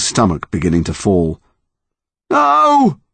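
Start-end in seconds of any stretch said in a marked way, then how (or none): 2.3-3.0 high panicked cry; terrified shout. Acted. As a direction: scream no in terror. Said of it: "he shouted".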